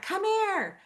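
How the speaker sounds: calling out. Retold or imitated. Mom calling her kids over at the rodeo, high call. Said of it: "And I'm, like, yelling".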